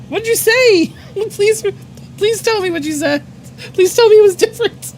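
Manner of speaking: high, hammed-up begging with nervous laughter; desperate pleading. Worker begging coworker to say it was different, pleading. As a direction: beg for reassurance, pitch right up.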